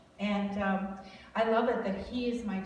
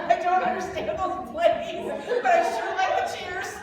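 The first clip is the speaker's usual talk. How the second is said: laughing; 9 semitones higher, 7 dB louder than usual. Real, not acted. Laughing at own football confusion.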